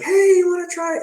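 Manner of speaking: very high sing-out offer; friendly vendor pitch. Retold or imitated. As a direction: imitate a stallholder luring people over. Imitates vendors offering samples again.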